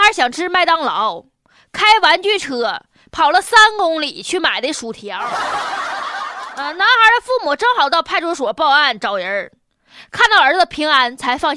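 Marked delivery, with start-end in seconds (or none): 5.2-6.5 airy unvoiced chuckle; short breathy laugh. Real, not acted. Laughs at funny news.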